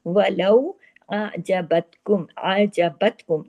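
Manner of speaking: वलौ आजबतकुम slow, ain sounded without stopping; careful ain. Shown as a demonstration. Teacher showing students the careful ain. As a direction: glide through the ain in आजबतकुम. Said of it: "बहुत एहतियात से पढ़ना होता है".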